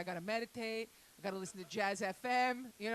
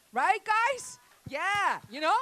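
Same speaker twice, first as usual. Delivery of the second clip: much higher and louder call to crowd; loud hype; 9 semitones higher, 12 dB louder, slower than usual. Real, not acted. Comic rallying the audience, loud and high. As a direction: whip the audience up.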